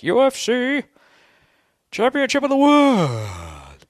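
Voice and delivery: very high, loud, drawn-out ring announcer call; over-the-top fight announcer. Pretend. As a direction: boom it out like a big fight intro, slowly. Announcer parody.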